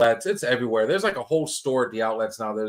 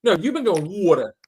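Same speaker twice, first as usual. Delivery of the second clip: louder, higher, mock disbelief; ribbing a friend, raised and loud; incredulous teasing; 5 semitones higher, 6 dB louder than usual. Real, not acted. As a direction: poke fun at how he says it. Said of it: "teasing the way he says water".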